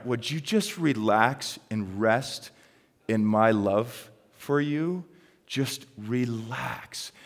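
slow, gentle voicing of God; unhurried, tender acted reassurance; soothing reassurance. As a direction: draw the words out calmly to soothe. Voicing God's soothing message.

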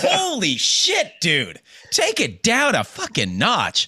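slow, high, stretched-out disbelief; reacting as if stunned, pleading; mock alarm. Speaker retells his slow, high disbelief at Tucker. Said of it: "And I was like".

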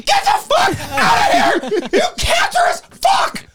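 very high, slow screaming rage; shrill flip-out. Acted. Doorman screaming at a sick kid fan, shrill and furious. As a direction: shriek it with everything. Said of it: "it always gets really shrill".

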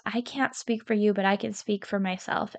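ordinary talk, nothing marked

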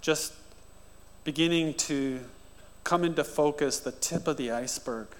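soft, reverent pastoral prayer; quiet heartfelt prayer over music; earnest prayer. Acted. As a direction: pray aloud over the congregation, hushed. Leads a prayer.

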